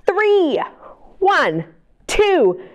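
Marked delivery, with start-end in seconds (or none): none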